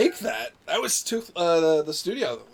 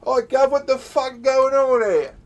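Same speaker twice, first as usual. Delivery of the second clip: loud, higher Cockney yell; Cockney accent; 8 semitones higher, 8 dB louder, slower than usual. Acted. Player demonstrating the gorillas' voice, Cockney accent. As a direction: holler it as a rowdy Cockney gorilla. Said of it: "I made them Cockney".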